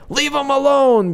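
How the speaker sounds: high mock yell of an angry father; father screaming. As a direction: imitate the dad shrieking from another room. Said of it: "and then the dad screaming".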